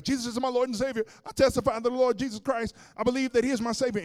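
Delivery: fast, loud, very high declaration; fervent cried-out testimony. Retold or imitated. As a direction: shout it out like a new convert. Delivered woman crying out testimony.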